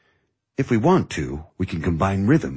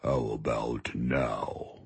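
very slow, deep and soft bass; low, bassy voice; 10 semitones lower, 10 dB quieter, much slower than usual. Shown as a demonstration. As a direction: drop right down and draw it out. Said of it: "he used a low, bassy voice".

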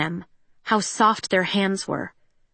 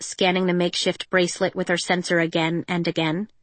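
ordinary delivery, nothing marked

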